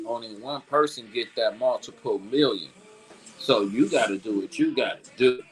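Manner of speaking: normal talking voice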